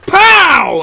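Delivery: high, loud mock gunshot; gunshot noise. Ending of Of Mice and Men, a mock gunshot. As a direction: fire it off. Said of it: "and then you're like".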